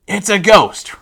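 milder acted ghost scare; mock alarm. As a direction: exclaim it as the obvious scary conclusion. Repeats the disciples' frightened cry.

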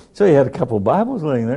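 ordinary talk

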